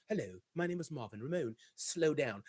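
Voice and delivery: put-on low, soft, suave British accent; British James Bond impression. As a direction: purr it like a smooth British spy. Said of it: "that dapper Don, that James Bond type".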